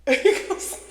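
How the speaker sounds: high, loud burst of laughter while talking; laughing. Real, not acted. Storyteller breaking up over the man's reaction, laughing loudly. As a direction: crack up mid-story, voice shooting up.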